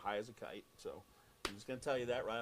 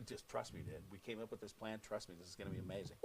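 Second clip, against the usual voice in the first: much softer and lower, quick confident murmur; hushed reassurance; 5 semitones lower, 9 dB quieter than usual. Retold or imitated. Host quietly assuring his partner the plan will work. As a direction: sell the secret plan quietly.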